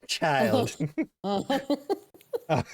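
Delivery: high laughing through a word. Real, not acted. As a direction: giggle out the word. Laughing at dark joke.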